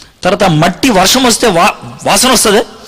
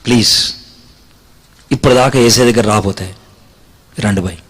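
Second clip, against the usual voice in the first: altar call; 9 semitones lower than usual. Acted. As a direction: draw them in gently to come forward.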